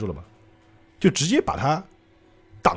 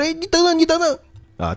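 high pleading call; calling after. Retold or imitated. Bobo calling after Jotaro as he leaves, high and pleading. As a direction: beg him to wait.

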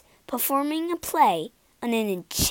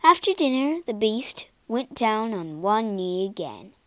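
plain reading voice

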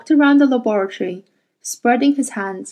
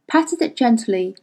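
usual speaking voice

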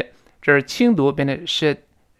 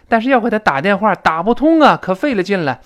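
higher-pitched, louder mock complaint; acted exasperation; 7 semitones higher, 4 dB louder than usual. Second speaker complains the call would not get through, acted exasperation. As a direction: play up how much trouble it was. Acted dialogue, failed phone calls.

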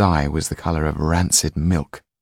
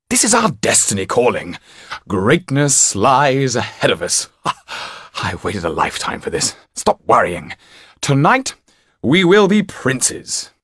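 grandiose magician; 6 semitones higher, 5 dB louder than usual. A narrator's reading. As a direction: proclaim a glorious destiny, brushing off doubts. Topolan dreams of greatness.

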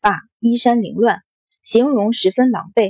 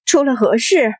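mildly startled question; a touch of alarm in the question; startled outcry; 2 semitones higher than usual. A narrator's reading. Newcomer at the door finding a brawl, startled outcry. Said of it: "有人失声道".

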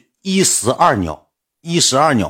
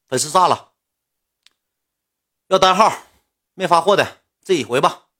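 sulky assistant; 6 semitones higher than usual. Retold or imitated. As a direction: shove the work back at him, annoyed. Assistant throws phone, sulks.

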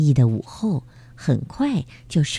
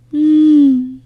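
loud high sob; 9 semitones higher, 7 dB louder than usual. Acted. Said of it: "他哭得真伤心啊".

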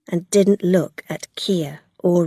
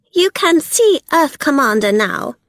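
a touch of cold, higher voice; cold Belle; 7 semitones higher, 5 dB louder than usual. A narrator's reading. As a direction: say it with chilly disdain, lightly voiced. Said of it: "she said coldly".

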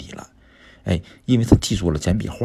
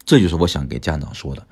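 ordinary talk, nothing marked

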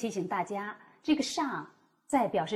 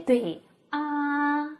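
normal talking voice

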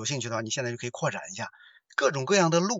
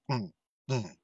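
clearing the throat; mock throat-clearing grunts; nasal hum of clearing the throat; 3 semitones lower, 6 dB quieter than usual. Shown as a demonstration. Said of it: "就是这个动作".

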